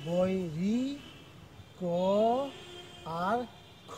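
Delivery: বৈ, রি, ক আর খ broken into slow separate sounds; spelled-out syllables. Shown as a demonstration. Teacher sounding out বৈ, রি, ক, খ for students. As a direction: sound out বৈ, রি, ক, খ one by one, pausing between. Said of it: "এটাকে উচ্চারণ করছি এরকম".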